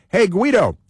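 high-pitched mock social media greeting; Guido. Host mimicking bridge protester's Twitter post, high mock greeting. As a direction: pipe up as the protester saying hello. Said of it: "He's got a Twitter".

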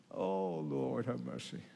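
breaking off into a hushed prayer; near tears. Real, not acted. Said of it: "Forgive me if I cry".

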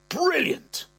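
put-on way up high, delighted old man; the old man, chuffed, in a high piping voice; old man's squeaky voice. Old dad to son, thrilled: high piping.

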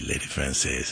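low, soft, cool jazzman murmur; lightly imitated deep smooth drawl. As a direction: drop it low and easy like a cool old sax player. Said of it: "he had that low voice and all that".

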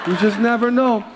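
laughing mother's warning; teasing mom. Retold or imitated. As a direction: warn him like a teasing mom. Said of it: "She goes".

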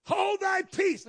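high, slow commanding shout; drawn-out shouted command; stern rebuke. Acted. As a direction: rebuke it with full authority. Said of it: "Jesus rebuked him".

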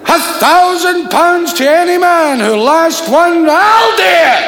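high, loud shouted exchange; loud Glasgow circus shouting. Acted. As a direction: bellow across the tent.

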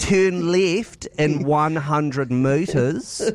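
put-on very slow, measured GPS instruction in a Kiwi accent; slow sat nav voice. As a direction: drag out each word like a careful navigation voice. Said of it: "a traditional Kiwi accent".

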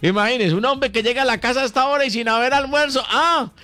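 high-pitched, building exclamation; mock indignation. Host protesting a man coming home to no lunch, mock indignation. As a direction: work up to a high, incredulous cry.

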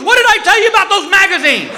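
high-pitched mock nagging; scolding wife. Wife telling husband off about magazines, scolding. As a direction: scold like a fed-up wife, imitated.

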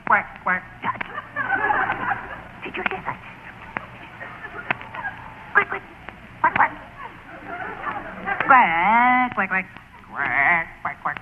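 mock duck quacks; quacking like a duck. Hunter keeps calling ducks with mock quacks. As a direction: keep quacking to call the ducks. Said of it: "you have to quack like a duck".

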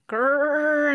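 loud, high effortful grrr; straining growl. A narrator's reading. Straining to flap and fly.

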